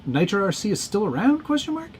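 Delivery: climbing, puzzled question read-out. Real, not acted. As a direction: rise high on "question mark". Reads a viewer's written question aloud.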